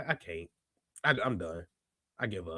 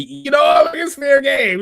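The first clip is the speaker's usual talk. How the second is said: loud; 8 semitones higher, 8 dB louder than usual. Retold or imitated. Voicing athletes' attitude.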